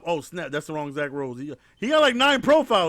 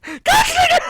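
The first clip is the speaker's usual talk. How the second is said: laughing shout; 6 dB louder, slower than usual. Real, not acted. Laughing explosion after the punchline.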